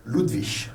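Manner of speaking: "Ludwig" ending in a soft ISH sound; final G as SH. Shown as a demonstration. Teacher giving one of three correct endings, soft SH. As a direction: say "Ludwig" as LOOD-vish. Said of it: "Les trois prononciations sont correctes".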